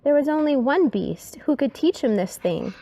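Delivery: ordinary delivery, nothing marked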